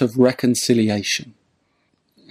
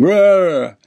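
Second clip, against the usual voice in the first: put-on higher, drawn-out drunken roar; mock bawling roar; 7 semitones higher, 4 dB louder than usual. Drunk tramp abusing train passengers, mock roar. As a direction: imitate a rowdy tramp's wordless bellow. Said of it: "he starts kind of bawling and".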